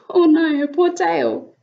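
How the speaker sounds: plain reading voice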